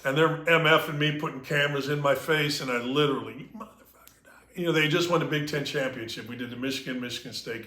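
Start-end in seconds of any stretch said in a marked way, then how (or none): 3.3-4.3 muttered whispered insult under the breath; a retold whispered curse at hecklers. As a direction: hiss it so the cameras can't hear.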